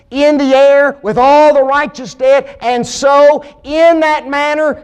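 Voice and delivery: high, loud preaching surge, each phrase driven up; full-force preaching. Acted. Preacher drives home the rapture to the congregation, full-force preaching.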